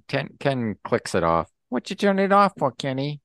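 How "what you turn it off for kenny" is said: high, fast and loud questioning; high squeaky sidekick. Acted. Duck questions Ken, acted quote.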